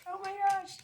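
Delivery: high-pitched squeal of delight; real giddy squeal over the reading; excited squeal. Real, not acted. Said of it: "I'm getting way too excited".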